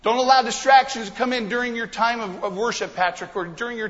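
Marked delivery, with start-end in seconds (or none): none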